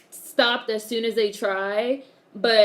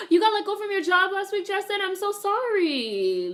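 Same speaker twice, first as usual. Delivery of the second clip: high gasped dismay, slowing into apology; shocked sympathy; 10 semitones higher than usual. Real, not acted. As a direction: react with a gasp, pitched way up. Shocked sympathy at viewer's job loss.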